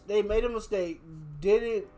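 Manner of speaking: ordinary talk, nothing marked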